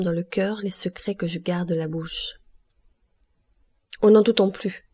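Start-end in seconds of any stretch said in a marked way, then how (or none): 4.0-4.8 loud, sudden outburst; sudden cry. A narrator's reading. Villefort suddenly stops doubting, crying out. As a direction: burst out all at once, louder. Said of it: "s'écria-t-il tout d'un coup".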